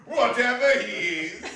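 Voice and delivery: high, loud and laughing. Acted. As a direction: chuckle through the quip. Nephew laughs at his quip.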